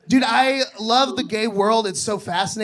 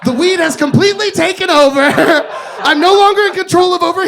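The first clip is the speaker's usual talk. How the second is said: laughing loudly through the words; 9 semitones higher, 10 dB louder than usual. Real, not acted. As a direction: talk while cracking up. Stand-up opening, laughing loudly.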